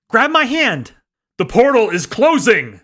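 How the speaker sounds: high, loud urgent warning; shouting. A narrator's reading. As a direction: yell over the noise to her. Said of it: "Agent Durwood shouted from behind her".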